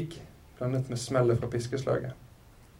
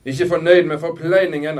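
loud, higher, theatrical proclamation; showman's announcement; 4 semitones higher, 9 dB louder than usual. A narrator's reading. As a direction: announce it to a whole crowd. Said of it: "annonserte han utover plassen, som om han holdt en forestilling".

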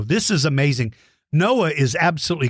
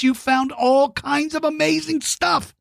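much higher, gushing over the finds; excited praise; 9 semitones higher than usual. Real, not acted. Host praising young fossil hunters, excited and much higher.

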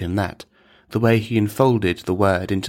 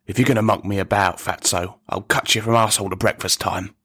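fast, threatening Cockney; Cockney accent. Acted. Teacher threatening a pupil after inhaling the accent, rapid Cockney. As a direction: rattle off a threat in broad Cockney. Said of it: "deep hit of the last Cockney accent".

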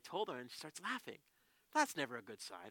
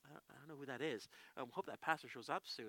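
puzzled aside; 3 semitones lower, 6 dB quieter than usual. Retold or imitated. Puzzled aside on hearing name.

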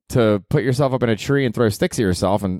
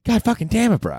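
frustrated cursing; 12 semitones higher than usual. Retold or imitated. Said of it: "something's like".

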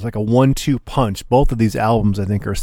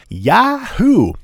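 much higher, gleeful yahoo; yahoo whoop; 10 semitones higher than usual. Pretend. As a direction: let out a happy yee-haw holler. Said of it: "It's gonna make me say".

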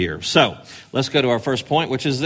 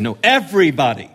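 sweeping proclamation; 4 semitones higher than usual. Shown as a demonstration. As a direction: call out to everyone at once. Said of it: "He's saying".

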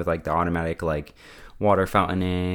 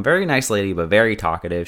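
ordinary delivery

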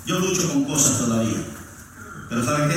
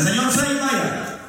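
a higher, wheedling bargainer begging for six; higher pleading haggle; 7 semitones higher, slower than usual. Retold or imitated. Preacher voicing the stubborn officer haggling with God, higher pleading. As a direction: coax it like a market haggler.

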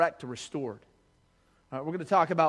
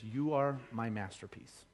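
tender God voice; 6 semitones lower, 9 dB quieter, slower than usual. Acted. God's voice, tender.

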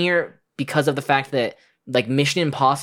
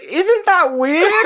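much higher, slow, laughing camp Dutch voice; Goldmember voice; 4 dB louder, much slower than usual. Retold or imitated. Goldmember introducing himself, high slow giggly question. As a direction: stretch it out with a giggle. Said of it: "And he's like".

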